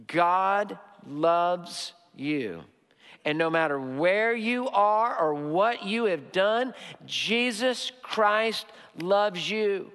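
very slow, weighty, word by word; slow, emphatic preacher's assurance; solemn declaration. Real, not acted. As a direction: let each word land with a long, deliberate stretch. Preacher solemnly declares God's love.

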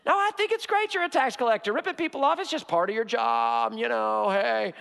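hammed-up pushover letting it all slide; mock condoning. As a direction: gush fake approval, then let it trail into excuses. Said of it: "there's not this condoning of".

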